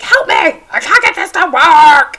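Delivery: hammed-up tech meltdown; frantic yell. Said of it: "and I was like".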